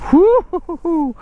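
high, drawn-out "whoo-hoo-hoo"; wobbly whoop. Real, not acted. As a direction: let out a high pulsing whoop while sliding. Sliding backwards down a slope.

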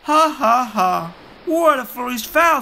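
high, loud jeering cackle; mocking pelican laugh. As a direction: laugh at him like a cheeky pelican. Perry Pelican laughing at Skylar.

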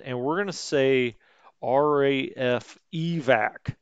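ordinary delivery

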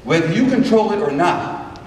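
ordinary delivery

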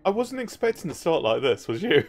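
amused, ending in a laugh. Real, not acted. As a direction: laugh it off at the end. Laughing at game start.

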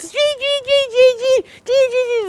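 very high chirping bird laugh; bird laughter. Acted. Birds laughing at the fake scarecrow. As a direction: cackle like delighted birds. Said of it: "The birds laughed".

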